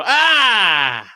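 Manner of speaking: big amazed gasp on entering a casino; shocked gasp. Shown as a demonstration. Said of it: "you go".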